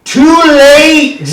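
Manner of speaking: high, loud, drawn-out shout from the crowd; heckler's yell. Retold or imitated. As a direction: holler it across a whole coliseum. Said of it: "just yells".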